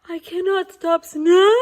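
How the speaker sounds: ordinary delivery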